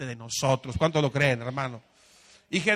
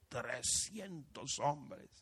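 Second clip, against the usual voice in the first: dramatic slow reveal; 3 semitones lower, 11 dB quieter, much slower than usual. Real, not acted. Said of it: "dramatic count".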